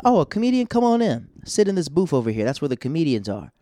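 high, loud sudden warm welcome, settling down; mock welcoming bouncer. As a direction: play the doorman suddenly all smiles. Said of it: "I said".

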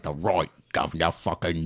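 hammed-up Cockney accent; mock Cockney. Shown as a demonstration. Showing friends the awful British accent, mock Cockney. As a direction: put on a broad Cockney street voice.

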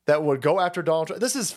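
ordinary delivery

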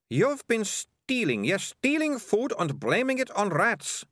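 put-on very high girl's voice, snapping; snapped accusation. A narrator's reading. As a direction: snap the accusation at the thugs. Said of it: "snapped Melissa".

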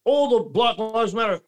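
high, sneering mock objection; mocking critics. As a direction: parrot the other side scornfully. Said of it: "but it's like".

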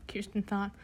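ordinary delivery

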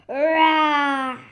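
loud drawn-out mock roar; playful roar. Small animals playing at bears, playful roar. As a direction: roar like a pretend bear. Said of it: "We're roaring!".

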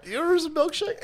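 high, put-on silly voice; lightly mocking goofy voice; mock character voice.

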